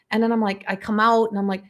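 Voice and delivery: ordinary delivery, nothing marked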